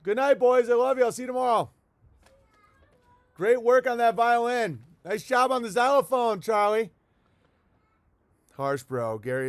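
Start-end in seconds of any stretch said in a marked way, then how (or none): 0.0-6.9 high, loud sing-song praise; doting parent voice. Pretend. Pretend parent saying goodnight to kids, high and gushing. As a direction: gush like a parent at bedtime.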